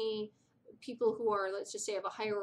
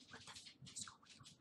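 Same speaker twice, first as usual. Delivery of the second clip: stunned whispered thought, much softer; 21 dB quieter than usual. Retold or imitated. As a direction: mouth it under your breath in disbelief. Retold inner reaction to yelling.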